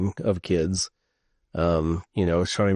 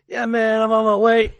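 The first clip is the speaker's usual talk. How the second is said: high, loud mock phone voice; loud phone talker; 14 semitones higher, 9 dB louder than usual. Friend imitating a man talking into his iPad, loud and high. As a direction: call it out loudly, imitating an oblivious caller.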